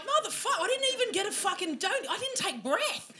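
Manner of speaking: high-pitched, exasperated outburst; high, indignant comeback; exasperated retort. Retold or imitated. Exasperated reply to rapid questioning.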